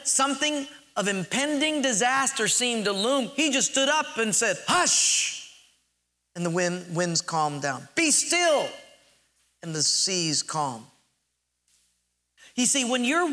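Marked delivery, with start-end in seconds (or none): 4.7-5.5 mock command, sharp high hush. Preacher voices Jesus silencing the wind, commanding. 8.0-8.7 high, commanding cry. Acted. Preacher voices Jesus calming the sea, commanding.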